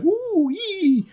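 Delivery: high-pitched mock "woo-yee" squeal; imitated giddy, drawn-out whoop; mock excited squeal. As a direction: squeal it like a gleeful fan, mockingly. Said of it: "making soy faces and going".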